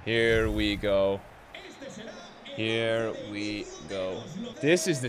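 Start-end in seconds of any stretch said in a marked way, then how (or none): 0.0-1.2 slow, low drawn-out words; slow drawl. Real, not acted. Match starting, drawn out. 2.4-4.2 very slow, quiet and low; weary drawl. Real, not acted. Repeated even slower, softer.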